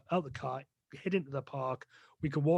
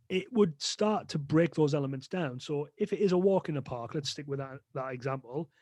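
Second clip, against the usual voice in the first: normal talking voice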